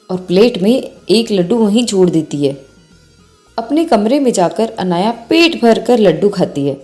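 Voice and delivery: plain reading voice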